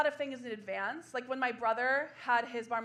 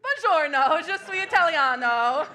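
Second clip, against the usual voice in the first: loud, hammed-up Italian greeting; bluffing stranger in a bar with mock Italian; fake Italian; 4 semitones higher, 11 dB louder than usual. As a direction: pass yourself off as Italian, loudly. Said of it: "Fourth language".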